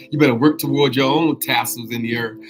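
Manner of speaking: loud, laughing admonishment; laughing tease. Real, not acted. Host ribbing listeners about their own tassels, laughing. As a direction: tease them loudly through laughter.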